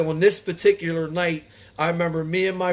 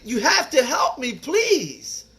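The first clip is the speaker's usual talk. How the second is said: pleading; 8 semitones higher, slower than usual. Retold or imitated. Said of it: "And I was like".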